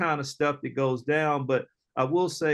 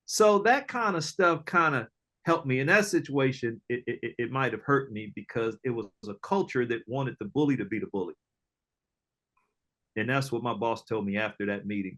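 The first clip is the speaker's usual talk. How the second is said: ordinary talk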